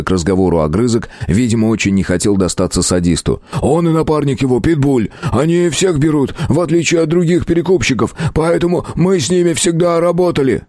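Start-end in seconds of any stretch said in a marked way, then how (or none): 3.6-10.6 higher-pitched defensive whine; pleading captive thug. A narrator's reading. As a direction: make excuses in a strained high voice. Captive eager to inform. (пленный бандит оправдывается, высоким голосом)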